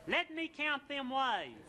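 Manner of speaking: high, slow mock-grand poetry recital with laughs; a giggling, dragged-out high recital of Browning; hammed-up recital. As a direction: overdo the poem like a bad stage reading. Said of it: "I recited, "How Do I Love Thee".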